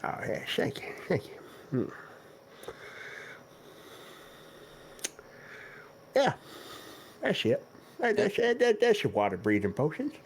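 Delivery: lightly voiced ancient shopkeeper; very old man. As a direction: mumble thanks like an old turtle. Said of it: "God, he's ancient".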